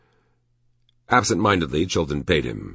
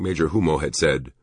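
ordinary delivery

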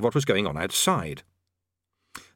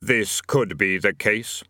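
bland Death; 2 semitones lower, much slower than usual. A narrator's reading. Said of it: "said Death blandly".

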